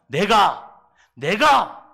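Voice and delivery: loud, high defiant cry; defiant shout. Acted. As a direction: insist on yourself, loudly. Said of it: "뭐라 그럽니까?". (반항하는 요나, 외치듯)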